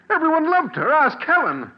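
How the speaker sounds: high, rising distress; pleading with the doctor, worked up; agitated outburst. Acted. Grieving father insists to doctor everyone loved her, agitated.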